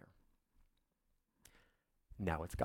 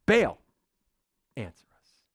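a short loud cry of Baal's name that falls away; shouted plea; 5 dB louder than usual. Acted. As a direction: call out to Baal, then drop off. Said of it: "they shouted".

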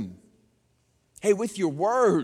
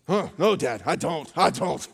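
imitating chastened child; 3 semitones lower than usual. Child answering dad's scolding.

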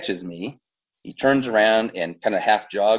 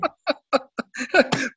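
real high laugh. Real, not acted. Laughs after There you go.

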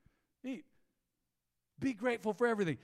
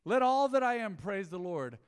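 loud, higher, ringing verse; proclaimed scripture; 5 semitones higher, 9 dB louder than usual. Real, not acted. Pastor reading the psalm aloud to the church, ringing.

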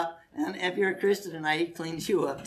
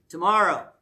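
usual speaking voice

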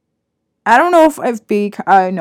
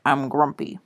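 lower, softer sulky grumble; grumpy customer mimic; 7 semitones lower, 6 dB quieter than usual. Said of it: "she's like".

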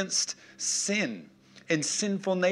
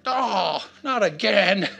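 raised-pitch comic groan of regret; mock exasperated wail; dismayed fish; 4 semitones higher, 5 dB louder, slower than usual. Preacher voices fish caught by the kids, dismayed. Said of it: "and the fish is like".

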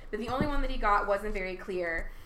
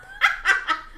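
burst of laughter; 10 semitones higher, 8 dB louder than usual. Real, not acted.

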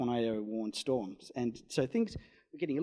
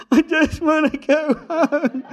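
much higher, louder, slow laughing plea; laughing whine; 13 dB louder, slower than usual. Retold or imitated. Driver repeating his plea to go home, laughing whine. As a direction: moan it through laughter, worn out.

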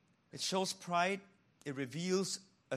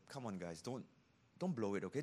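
softer and lower, coaxing; a hushed, lower caution; gentle warning; 6 semitones lower, 5 dB quieter, faster than usual. Retold or imitated. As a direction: plead quietly with the guys. Voicing Paul warning people.

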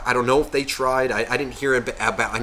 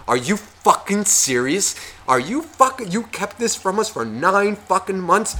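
mock indignant scolding; 7 semitones higher than usual. Relative scolding the new parents.

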